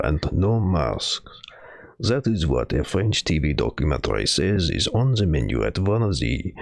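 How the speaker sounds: slow, hammed-up French accent reading news; a lightly put-on French accent, slow; bad French accent. Streamer reading the French article in a mock French accent. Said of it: "I'll read the whole French one in a shitty French accent".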